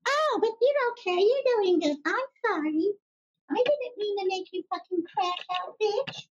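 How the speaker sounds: sarcastic sugary coo, high pitch; mock sweet. Mock soothing apology, much higher.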